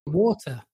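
"Water" as "waw-tuh", no final R; British "Water", rounded first vowel; British pronunciation. Shown as a demonstration. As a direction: say "Water" with a crisp T and no R. British speaker demonstrates own pronunciation.

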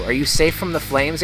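ordinary delivery

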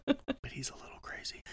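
a breathy whispered aside, half hidden. Real, not acted. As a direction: lean in and whisper it as a secret jab.

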